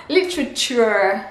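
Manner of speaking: Literature ending lit-ruh-chur; slow English Literature. Shown as a demonstration. Teacher repeating Literature for students. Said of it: "un son un peu étrange à la fin".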